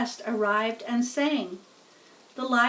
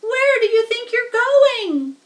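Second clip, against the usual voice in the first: much higher, louder frantic pig scream; 12 semitones higher, 10 dB louder than usual. Acted. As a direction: yell it after the spider, panicking. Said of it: "screamed Wilbur".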